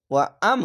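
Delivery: "ওয়া আম" with a sudden push on আম; jolting emphasis. Shown as a demonstration. Teacher shows learners the sakin, pushed with a jolt. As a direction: jolt the আম and stop it short. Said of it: "এখানে ঝোঁক মেরে পড়তে হবে".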